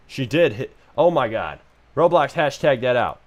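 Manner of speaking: usual speaking voice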